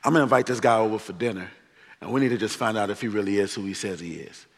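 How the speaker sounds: Pharisee plotting. Retold or imitated. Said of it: "religious leaders is like".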